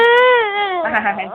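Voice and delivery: bright burst of laughter; laughing. Real, not acted. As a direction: laugh at your own joke. Laughing after teasing the baby.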